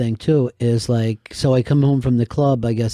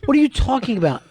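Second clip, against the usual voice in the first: stunned disbelief; 11 semitones higher, faster than usual. Retold or imitated. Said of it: "I'm like".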